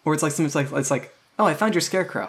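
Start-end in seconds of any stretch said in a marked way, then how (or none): 1.4-2.3 high-pitched, casual aside; in-character remark. Acted. Player suggests a line to the game master, high casual delivery. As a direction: offer it lightly, pitched up.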